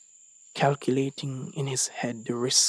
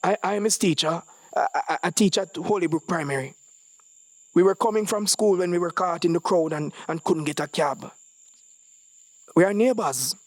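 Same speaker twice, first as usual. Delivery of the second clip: higher, fast, stammering nervous lie; 6 semitones higher, 3 dB louder, faster than usual. Acted. Frightened boy lying about his name to police, stammering. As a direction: stumble over the words, scared.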